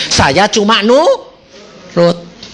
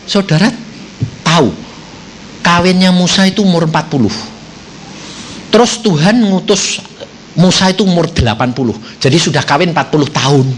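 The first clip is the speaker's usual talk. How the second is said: normal talking voice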